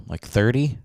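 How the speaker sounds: normal talking voice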